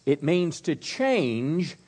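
slow, weighty definition; slow stress on change; slow emphasis. Real, not acted. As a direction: lean on each word, slowly.

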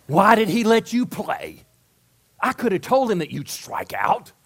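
high, loud mock reproach; acted berating. Critic berating the player who struck out, acted indignation.

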